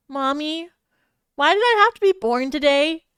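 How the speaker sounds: lightly voiced child. A narrator's reading. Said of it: "Yutaka asked with teary eyes".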